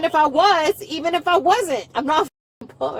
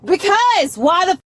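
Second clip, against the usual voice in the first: raised, high-pitched angry retort; outraged, insistent screaming; 4 semitones higher, 4 dB louder, faster than usual. Real, not acted. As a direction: yell it back at the officer. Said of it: "Can you stop? ... Can you please stop screaming?".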